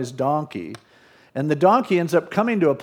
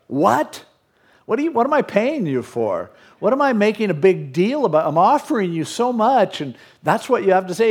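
higher-pitched, exasperated disbelief; mock indignation; 5 semitones higher than usual. Balak protesting to the prophet he is paying, mock indignant. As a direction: act out an affronted complaint, pitch up. Balak hears bad news from Balaam.

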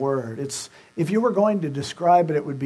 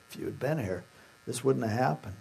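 very soft, low, grieving; hushed lament, gently acted; tearful, not accusing; 3 semitones lower, 8 dB quieter, faster than usual. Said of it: "She was weeping and crying".

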